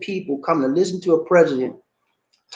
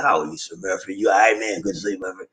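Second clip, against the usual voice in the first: low Wendell voice; 10 semitones lower, 4 dB quieter, faster than usual. Retold or imitated. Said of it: "he said".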